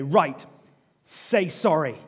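put-on a firm parent telling off a child; sharp, loud parent order; stern parent.

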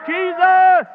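high triumphant shout of the name; shouted answer. Acted. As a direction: shout it out for the whole church.

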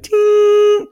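very high mock machine ding; card machine ding. Imitating the card machine rejecting payment, high ding. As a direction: chirp it like a card reader.

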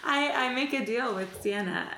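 ordinary delivery

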